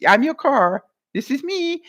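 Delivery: playful high voice for a talking car; voicing the car. Imagining her car greeting her, a playful high voice. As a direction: pretend to be the car saying hello.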